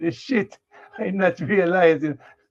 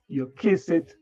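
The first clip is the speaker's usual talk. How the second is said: ordinary talk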